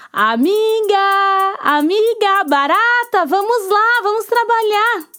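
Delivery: high, bright call to a friend; cheerful hen calling. Acted. Hen knocks and calls the cockroach to work.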